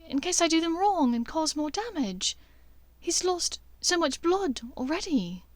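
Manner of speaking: Hermione explains, afraid of doing harm; worried Hermione. Acted.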